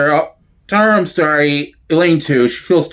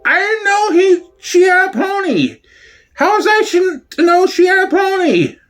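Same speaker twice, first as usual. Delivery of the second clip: crying, much higher, pleading; tearful wail; 13 semitones higher than usual. Acted. Guilty after upsetting Manya over the pony, crying. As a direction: sob it out, half shouting in distress.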